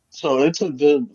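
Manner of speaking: normal talking voice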